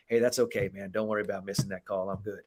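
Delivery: soft, easygoing forgiveness; genuine reassurance. Retold or imitated. Player reassuring a ref after a missed call, softly sincere. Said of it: "when you genuinely say to a ref".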